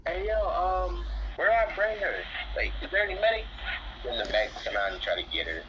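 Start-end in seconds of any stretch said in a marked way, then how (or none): 0.0-3.5 high, loud call for help; raised, high-pitched hail. Acted. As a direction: shout across the arena for a medic. Calls out for medics.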